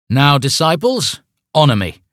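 grandiose command. Acted. Cult leader commands.

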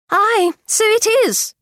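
high, slow, excited exclamation; exclaiming. A narrator's reading. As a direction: cry it out high and drawn out. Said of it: "cried her mother".

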